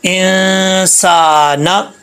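"इंसाना" chanted slowly on a level tone, न held; recitation demo. Shown as a demonstration. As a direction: recite "इंसाना" drawn right out from a clear hamza. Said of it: "अब ज़ेर है तो ये क्या है". ("इंसाना" हमज़ा से शुरू करके धीरे, खींचकर पढ़ें)

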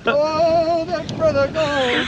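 loud high cheering chant; excited. Real, not acted. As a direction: cheer the fish on. Friend cheering the tarpon.

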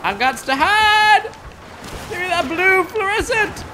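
high, loud frantic yelling; panicked shouting. Real, not acted. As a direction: scream it out while fleeing. Being hunted, shouting in panic.

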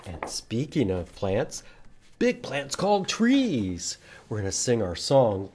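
usual speaking voice

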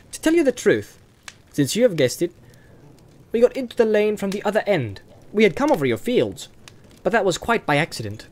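high-pitched, apologetic hobbit; youthful, lifted voice making excuses; explaining young hobbit Pippin. Acted. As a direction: explain the trespass lightly and politely.

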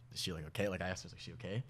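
retold quiet aside. Asking about the girl hanging back, quiet aside. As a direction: drop to a hushed, discreet question.

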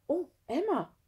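high, startled softened tone; breathed surprise. A narrator's reading. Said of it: "hauchte sie". (hoch und betroffen gehaucht)

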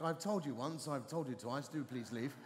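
low, softening, deliberately civil paraphrase; mock-polite dismissal. Vicar cleans up the woman's rebuff, mock polite. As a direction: tone a rude rebuff down into quiet courtesy. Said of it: "she basically said something like".

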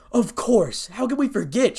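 high, loud exclamation of horror; horrified shout. A narrator's reading. Kiba realizing what they all forgot, horrified shout. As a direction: cry out, appalled at forgetting. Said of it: "shouted Kiba in horror".

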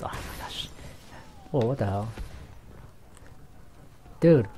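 normal talking voice

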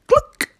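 high, loud, short cluck; sudden high hen call; hen's cluck. A narrator's reading. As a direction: squawk it like a hen. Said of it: "the hen said".

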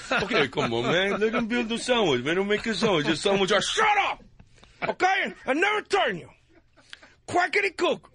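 exasperated mock gangster, bursting into high shouts; Scarface. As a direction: build from grumbling to a high outburst. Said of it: "Scarface on there".

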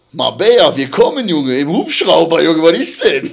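calling out loudly and excitedly; excited shouting. Real, not acted. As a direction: shout it across to the friends. Calling out to friends.

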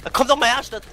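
taunting. Real, not acted. Taunting enemies in game.

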